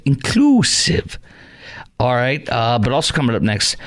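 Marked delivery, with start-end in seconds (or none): 0.0-1.2 "Inclusive" higher and slow, sarcastically drawn out; mocking repeat. Shown as a demonstration. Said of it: "Gotta say simplified or inclusive".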